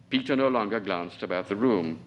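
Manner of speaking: usual speaking voice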